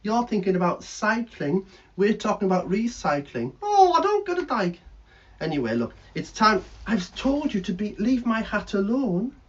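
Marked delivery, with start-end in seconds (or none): 3.6-4.8 high, loud puppet voice; cheeky bird puppet voice; squeaky puppet bird. Acted.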